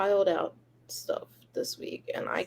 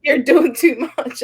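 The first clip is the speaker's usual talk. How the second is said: mock scolding; 5 semitones higher, 11 dB louder, slower than usual.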